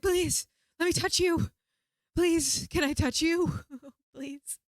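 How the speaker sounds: high, loud acted plea; desperate begging. Begging the helper to let her touch him, desperate. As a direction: beg in panic, fading on the last word.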